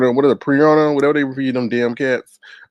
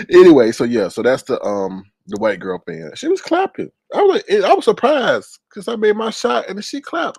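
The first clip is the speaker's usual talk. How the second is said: usual speaking voice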